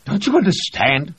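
sudden high, loud outburst; burst out. A narrator's reading. Reed bursting out at Vosnesensky, high and loud. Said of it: "Reed burst out".

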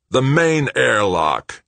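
high, loud, drawn-out crow of triumph. A narrator's reading. Greg hitting on the airlock plan, crowing. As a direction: crow it slowly and gleefully. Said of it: "Greg crowed".

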